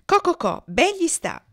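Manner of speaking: high clucking gloat; squawking hens. A narrator's reading. Hens surround the boy squawking, high clucking taunt. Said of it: "starnazzando più che mai".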